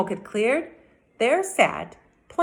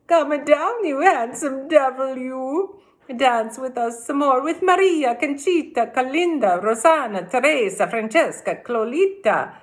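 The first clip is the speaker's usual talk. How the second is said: higher, louder calling ladies; cried; 6 semitones higher, 4 dB louder than usual. A narrator's reading. Ladies shaking the tree, higher excited calling. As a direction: coax him down like eager village ladies. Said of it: "they cried".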